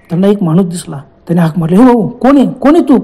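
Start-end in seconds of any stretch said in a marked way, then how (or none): 1.8-3.0 raised, higher call to a distant man. Retold or imitated. Hiker calling to a stranger in the jungle, loud call. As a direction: call out to the figure in the trees.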